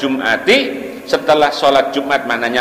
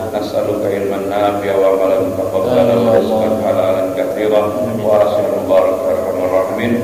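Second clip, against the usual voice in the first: low, evenly intoned supplication; closing prayer; 8 semitones lower than usual. Real, not acted. Preacher closes the lesson with supplication, low even prayer. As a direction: intone the Arabic on one low, steady pitch.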